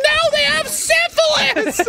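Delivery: loud, high, drawn-out scream; hammed-up shriek to stop someone; mock desperate warning. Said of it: "They're like".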